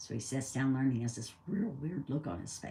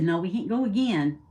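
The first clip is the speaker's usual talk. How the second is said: firm refusal; 6 semitones higher, 8 dB louder than usual. Retold or imitated. Refusing the kid's request.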